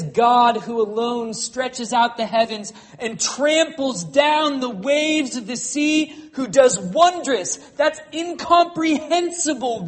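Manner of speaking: loud, high, ringing pulpit voice; thundering sermon delivery that climbs in pitch; full-force preaching. Acted. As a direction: preach it out over the whole room.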